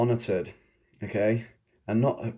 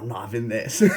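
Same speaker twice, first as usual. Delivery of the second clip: softer, laughing through it; laughing retort; 4 dB quieter, faster than usual. Retold or imitated. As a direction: giggle out the refusal.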